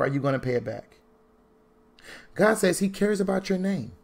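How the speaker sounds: ordinary talk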